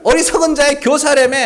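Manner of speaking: high-pitched needling jeer; sarcastic teasing; mocking sarcasm. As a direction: throw their boast back at them, high and taunting. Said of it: "비아냥거리는 거예요".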